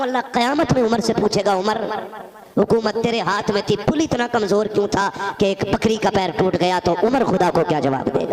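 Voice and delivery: loud, solemn warning; loud solemn pulpit warning; fiery preaching. Acted. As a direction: warn them of judgement. Preaching at full force.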